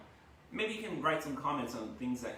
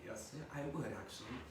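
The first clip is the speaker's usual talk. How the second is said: much softer, hushed eager yes; hushed delight at a new Porsche; 8 dB quieter than usual. Retold or imitated. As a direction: quietly jump at the offer. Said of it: "I'm like".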